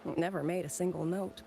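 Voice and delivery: ordinary talk, nothing marked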